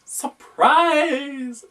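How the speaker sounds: very high, loud showman's cry of Surprise; hammed-up high worm voice; worm exclaiming. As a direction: fling it out like a party trick reveal. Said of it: "exclaimed the worm".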